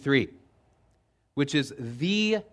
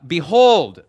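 loud, swooping exclamation; exclaimed behold; 3 semitones higher, 11 dB louder than usual. Acted. Preacher quoting the scripture's account of the chariot, exclaimed. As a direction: proclaim it loudly, like a sudden sighting.

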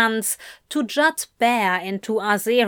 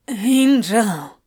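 Crowley, breathless; 4 dB louder than usual. A narrator's reading. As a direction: murmur it like a lover.

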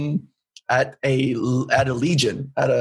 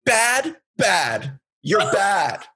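imitated scolding with higher, punched words; fuming old lady; 5 semitones higher, much slower than usual. Old lady confronting the comedian after his set, fuming. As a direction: play her blurting it out, separate angry bursts. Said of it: "this old lady who fuming".

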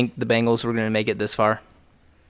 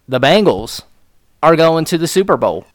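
loud, raised, proud proclamation; emphatic repeat announcement; 6 semitones higher, 9 dB louder than usual. Real, not acted. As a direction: proclaim it like a thrilled fan. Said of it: "Let me say it again".

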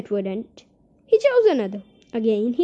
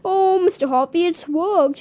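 excited cry; 6 semitones higher, 4 dB louder than usual. Acted. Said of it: "she was crying".

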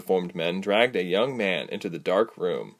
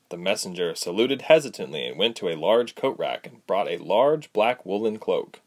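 ordinary delivery

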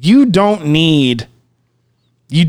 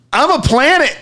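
awed announcement; 10 semitones higher than usual. Retold or imitated. Tripping friend announcing his epiphany.

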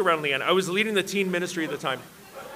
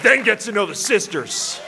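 raised, emphatic scolding, played for laughs; mock angry rebuke; 6 semitones higher than usual. Brother rebuking a smitten newcomer, mock angry. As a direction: imitate a stern telling-off, hitting it hard. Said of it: "He turned to me angrily".

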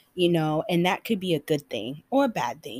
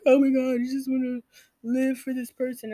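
gushing fan; 8 semitones higher, 3 dB louder than usual. Retold or imitated. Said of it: "I was just like".

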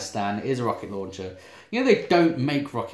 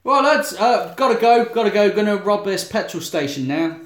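higher, louder, hurried sign-off; loud hasty announcement; 8 semitones higher, 6 dB louder than usual. Acted. Robber taking leave of the lads, loudly announcing it.

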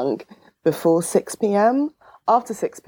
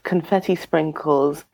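normal talking voice

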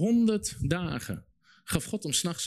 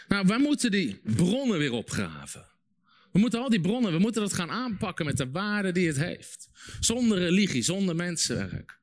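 rally the church, voice raised; preaching at full force; 7 semitones higher than usual. Acted. Pastor urging the congregation, preaching at full force.

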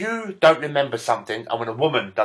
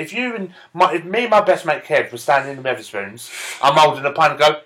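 ordinary talk, nothing marked

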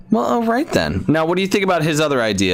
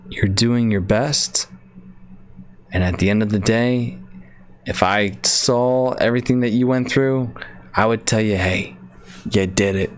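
mock meditation guide. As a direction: play the soothing coach for the listeners. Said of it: "Welcome to the A S M R segment".

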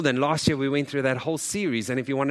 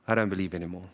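low, soft retold admission of doubt; deflated; 7 semitones lower, 5 dB quieter, faster than usual. Believer a week after thanking Jesus, acted deflation. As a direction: drop right down, as if faith has drained.

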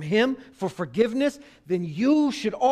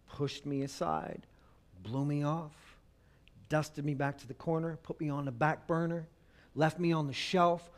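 quiet hurt; 4 semitones lower, 7 dB quieter than usual. Acted. Husband voiced, hurt and low.

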